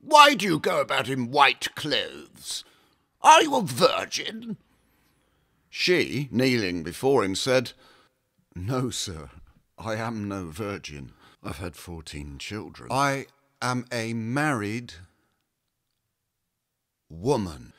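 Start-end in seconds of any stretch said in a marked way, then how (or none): 0.0-4.6 curt, higher challenge from the archbishop; stern prelate questioning a kneeling woman; abrupt demand. A narrator's reading. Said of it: "said to her abruptly". 8.5-17.7 quiet kneeling wife answering the archbishop; humble soft reply. Acted.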